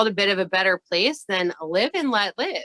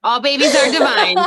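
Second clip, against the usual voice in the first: louder, raised, with a preacher's ring; grand proclamation; 5 semitones higher, 8 dB louder than usual. Acted. Proclaiming to listeners that all babies are divine, in a booming preacher voice. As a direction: announce it like a blessing from a pulpit.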